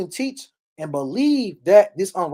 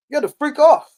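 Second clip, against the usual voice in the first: 1 indignant shout; 10 semitones higher, 8 dB louder than usual. Real, not acted. Preacher's indignant outburst.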